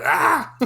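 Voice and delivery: sharp high yelp of annoyance; frustrated yelp. Retold or imitated. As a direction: yelp at your own stumble. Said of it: "I can hear myself stumble and I'm like".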